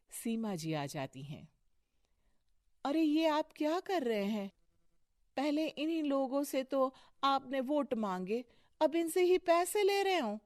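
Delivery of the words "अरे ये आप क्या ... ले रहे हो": high-pitched reproach, rising higher; indignant protest. Acted. Wife catching husband taking a bribe, indignant. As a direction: confront him, scandalised.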